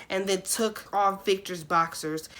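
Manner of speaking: ordinary delivery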